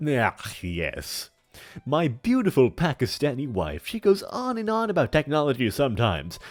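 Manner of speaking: put-on big theatrical old villain voice; hammy stage actor. Plays a hammy character in chat.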